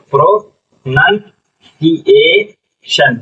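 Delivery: "Pronunciation" very slowly as pro, नन, सी, ए, शन; syllable by syllable. Shown as a demonstration. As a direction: sound out "pronunciation" in separate chunks. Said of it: "क्या पढ़ेंगे?". ("Pronunciation" धीरे-धीरे, हर हिस्सा अलग)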